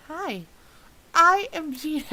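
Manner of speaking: high, put-on British voice; Gino, British voice. Reader trying out Gino's character voice, put-on British accent. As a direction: introduce yourself in a mock British accent. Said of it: "I picture him with the British voice".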